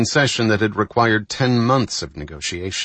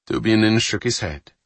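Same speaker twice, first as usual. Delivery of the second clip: plain reading voice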